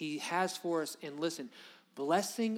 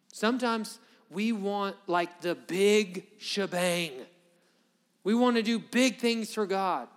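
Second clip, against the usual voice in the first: louder and higher, swelling up; mock grand; 4 semitones higher, 8 dB louder, slower than usual. Preacher to congregation, mocking their wish for big things, loud and swelling. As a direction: puff up the big dreams, then let them burst.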